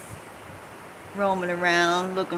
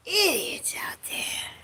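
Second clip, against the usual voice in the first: slow, breathy, higher whisper; 10 semitones higher, 4 dB quieter, slower than usual. Real, not acted. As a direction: hiss it with contempt. Repeats it whispered.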